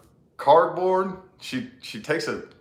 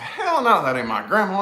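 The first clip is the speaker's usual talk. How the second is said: loud retort; 9 semitones higher, 4 dB louder than usual. Retold or imitated. Retold refusal at Ouija board.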